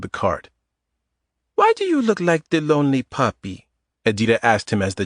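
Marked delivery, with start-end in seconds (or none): 1.5-3.7 put-on Eastern European accent. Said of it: "In a light Eastern European accent".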